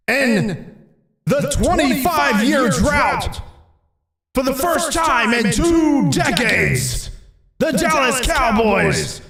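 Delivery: high, slow shouted game call; sports announcer. Acted. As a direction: boom out the historic win. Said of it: "It's like Dallas just won the Super Bowl".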